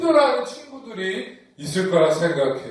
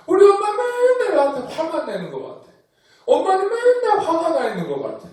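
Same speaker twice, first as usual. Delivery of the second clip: put-on higher, fast whining kid; whiny child complaint; 9 semitones higher, faster than usual. Child grumbling to the class about an always angry mom.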